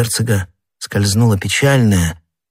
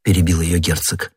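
ordinary delivery, nothing marked

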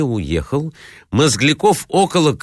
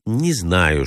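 ordinary delivery

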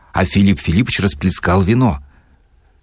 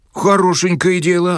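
higher, startled protest; a touch of fright, pitched up; frightened; 11 semitones higher than usual. Acted. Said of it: "испугавшись". (Шариков испуганно, голос выше)

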